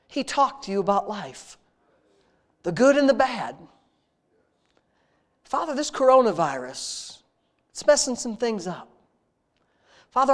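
earnest, intimate prayer voice, addressing God like a father; heartfelt spoken prayer. Real, not acted. Pastor leading his congregation in prayer, earnest and intimate. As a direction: pour out thanks to God in an earnest rhythm.

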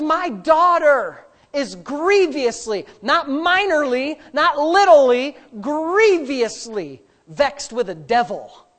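slow, stressed quote of the mother; drawn-out pleading emphasis; emphatic quote. Acted. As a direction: lean on grievously with slow emphasis. Preacher voicing desperate mother.

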